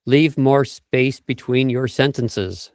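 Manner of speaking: slow, with long gaps between words; spaced-out pace. Shown as a demonstration. Host asks if he's demonstrating, slow spaced delivery. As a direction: let the words hang apart slowly. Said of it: "are you demonstrating?".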